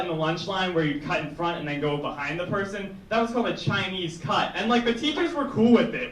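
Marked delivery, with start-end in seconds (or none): none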